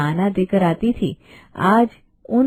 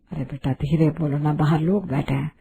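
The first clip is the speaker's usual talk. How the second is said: hushed pleading; 5 semitones lower, 6 dB quieter than usual. Acted. Mother-in-law hushing the bride because guests sit outside, low and urgent. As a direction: lower and softer, urgent hush.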